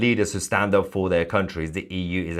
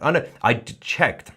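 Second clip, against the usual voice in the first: ordinary talk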